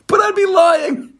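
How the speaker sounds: high, breaking into laughter; laughing. Real, not acted. Host cracking up at his own joke. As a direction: laugh through the punchline.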